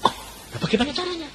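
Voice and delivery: quick, high, soft question; puzzled reply. Retold or imitated. Pupil asking God how it will work, puzzled. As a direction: pipe up fast in a small high voice. Pupil answers God's promise.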